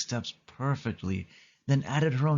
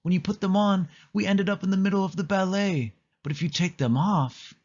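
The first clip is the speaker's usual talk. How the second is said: put-on much higher girlish voice; high excited girl's voice; Haley's voice; 9 semitones higher, faster than usual. A narrator's reading. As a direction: lift the voice high into a young girl. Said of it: "said Haley".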